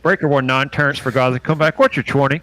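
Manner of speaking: loud Southern trucker on the CB radio. Pretend. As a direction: holler it like a trucker over the radio. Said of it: "You do that really well, like you're from Tennessee".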